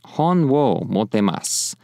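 "Hon wo mottemasu" spoken slowly and clearly; Japanese model phrase. Shown as a demonstration. Teacher repeating the phrase, slow and clear. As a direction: say it slowly for students to copy. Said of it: "I'll just say it".

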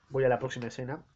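ordinary delivery

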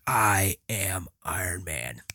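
a touch of soft, slow hero resolve; quiet movie line. Retold or imitated. Tony Stark before the snap, soft and slow. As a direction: breathe it out softly and slowly. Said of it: "he's like".

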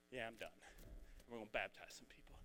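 soft whisper to the crowd; whispered aside. Real, not acted. Preacher whispering a teaser to the congregation. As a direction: let them in on a secret.

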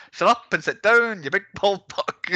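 high, sing-song film-quote insult; Cousin Avi. Retold or imitated. Podcaster showing friends how poetic the line is, tuneful high imitation. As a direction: savour the tune of the put-down, pitched well up. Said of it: "so tuneful the way he's".

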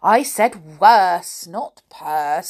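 slow, loud stress on "worse"; emphatic correction. A narrator's reading. Silky correcting the deaf man, "worse" loud and slow. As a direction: hit "worse" hard, then drop for "not purse".

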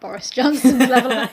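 usual speaking voice

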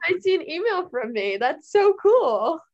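high, loud gushing mom; excited gushing. Retold or imitated. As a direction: gush like a thrilled mom. Quoting friend's excited mom.

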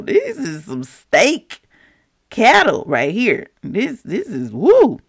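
high, animated sales pitch; hammed-up excited excuse-making; mock protesting. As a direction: marvel over prize livestock, drawing words out. Acts Saul defending the choice livestock.

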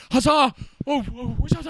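high theatrical wizard exclamations; grandiose showmanship. Acted. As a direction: cry it out like a showy stage wizard. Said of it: "this grandiose showmanship to it".

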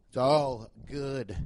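low and drawn out, word by word; slow playful drawl. Real, not acted. Host reassures listeners about shirts, slow drawn-out catchphrase.

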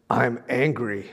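low, slow pretend-angry child; drawn-out, playfully grumpy "angry"; mischievous mock anger. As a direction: imitate a granddaughter teasingly faking anger. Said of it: "she got this mischievous look on her face".

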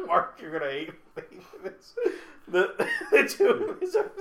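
laughing through the words; giggling, can barely get it out; cracking up mid-line. Real, not acted. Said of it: "sorry, I'm laughing at my own joke".

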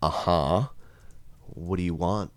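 low, soft, slow insult; hushed, unhurried mock disdain; deadpan contempt. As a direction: let it out quietly with long pauses. Priest's made-up deadpan reply.

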